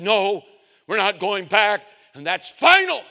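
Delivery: loud, high, final; stern. Retold or imitated. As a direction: slam the door on it. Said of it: "And sternly".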